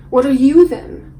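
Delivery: loud, acted outburst; demanding challenge. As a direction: throw the question back at them forcefully. Acted confrontation, challenging the group.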